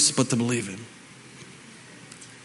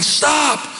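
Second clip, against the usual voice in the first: shouting stop; 10 semitones higher than usual. Retold or imitated. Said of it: "I'm like".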